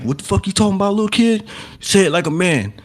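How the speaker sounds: high-pitched imitation of a man challenging a kid; confrontational stepdad. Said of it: "the stepdad or the boyfriend confronted Andy".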